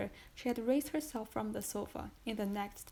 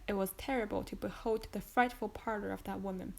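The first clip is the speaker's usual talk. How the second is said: usual speaking voice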